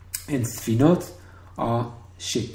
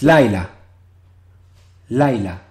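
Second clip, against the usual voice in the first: LAI-la, first syllable punched louder, falling after; stress on first syllable; 9 dB louder than usual. Shown as a demonstration. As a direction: hit the LAI of Laila hard. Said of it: "put the stress on the, uh, first syllable".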